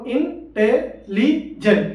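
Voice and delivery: Intelligent very slow, four detached syllables; split in four. Shown as a demonstration. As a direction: count out in, te, li, jent with gaps in intelligent. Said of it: "चार word बोल".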